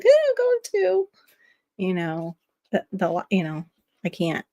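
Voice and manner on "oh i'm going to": high, put-on voice excusing herself from family; sing-song mock excuse, pitched well up. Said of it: "be like".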